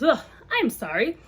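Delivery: usual speaking voice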